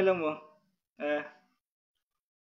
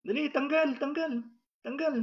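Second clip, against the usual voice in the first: urgent high command; 7 semitones higher, 3 dB quieter than usual. Real, not acted.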